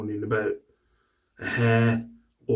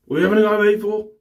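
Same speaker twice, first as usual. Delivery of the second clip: indignant protest; 10 semitones higher, 5 dB louder, much faster than usual. Retold or imitated. Dad protesting to wife.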